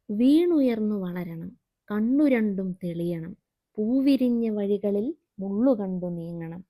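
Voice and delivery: slow, measured verse recital; poem recitation. Shown as a demonstration. Teacher reciting the mother's advice poem to children, slow. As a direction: recite each line with weight.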